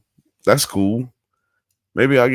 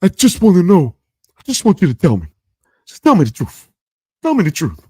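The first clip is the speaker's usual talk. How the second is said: high, pleading put-on voice; Forrest Whitaker impression; 9 semitones higher, 5 dB louder, faster than usual. Comic imitating an actor with a crazy lazy eye, high pleading impression. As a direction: raise the pitch and plead earnestly, in character. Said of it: "And he said".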